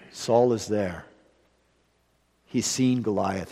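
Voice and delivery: usual speaking voice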